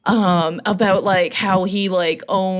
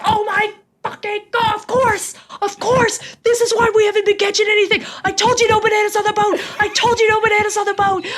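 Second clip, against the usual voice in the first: hammed-up frustrated yell, fast; imitating a yell; 10 semitones higher, faster than usual. Fishing guide yells at laughing family about bananas, mock yell. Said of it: "he had to yell because we were all laughing".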